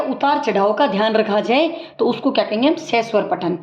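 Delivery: normal talking voice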